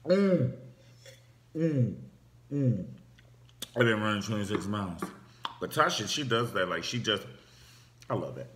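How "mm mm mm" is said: high, loud mm fading down; satisfied hums of relief; savoring gulp hums. Retold or imitated. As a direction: relish a long-awaited drink. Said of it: "you be like".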